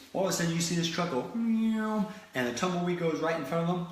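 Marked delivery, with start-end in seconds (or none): 1.3-2.1 imitated engine zoom. Truck blows through tumbleweed.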